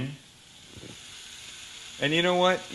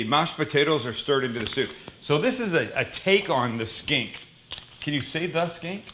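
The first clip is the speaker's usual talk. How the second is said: ordinary talk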